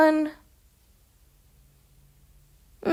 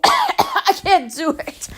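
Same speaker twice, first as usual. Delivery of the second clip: high, coughing and strained; choked-up croak after straining the voice; 8 semitones higher, slower than usual. Real, not acted. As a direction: give up through a cough, throat hurting. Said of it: "It hurt my, my throat to even do it".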